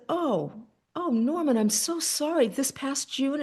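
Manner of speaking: reach out gently with sorrow; heartfelt sympathy. Retold or imitated. Sympathizing with a widower.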